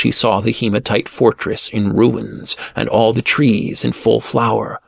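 ordinary delivery, nothing marked

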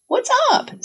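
very high, casual opener; breezy confrontation. A narrator's reading. How Sarah would ask a partner, breezy. Imagined breezy question to boyfriend.

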